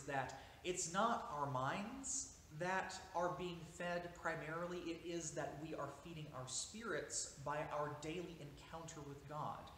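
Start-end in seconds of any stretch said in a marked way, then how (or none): none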